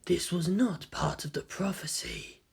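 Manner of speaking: slow, ominous whisper. Acted. A hidden voice warning Kaworu about the prophecy, in a slow whisper. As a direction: breathe the warning slowly.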